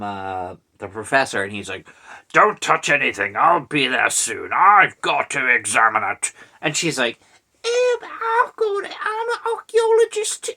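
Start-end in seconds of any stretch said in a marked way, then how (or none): 2.3-6.3 put-on professor voice, punched out; mock professor. Professor phoning his assistant, mock urgent orders. 7.6-10.5 very high mock British woman; British accent. Archaeologist answering the professor, put-on British accent. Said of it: "I gave her a British ac-, British accent".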